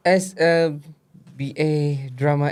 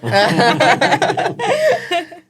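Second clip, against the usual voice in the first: high laugh; 8 dB louder than usual. Real, not acted. Laughs at a joke.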